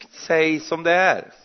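mock impatient plea. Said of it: "en liten sån undervisning med mig här".

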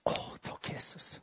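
breathy whispered prayer to Jesus; whispered thanks. Real, not acted. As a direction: whisper it reverently. Whispered prayer after amen. (hvisket takk til Jesus)

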